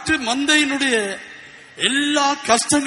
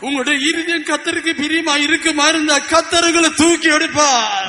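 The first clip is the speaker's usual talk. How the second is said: preaching at full force; 4 semitones higher, 4 dB louder than usual. Acted.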